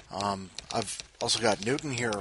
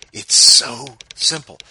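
mock emphasis; 13 dB louder, slower than usual. Real, not acted. Host stressing how easy recording is.

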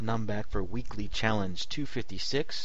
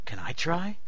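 eager, higher-pitched question; excited asking; 8 semitones higher than usual. A narrator's reading. Man asking to try teleporting, excitedly. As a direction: ask it with mild excitement. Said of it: "the man asked excitedly".

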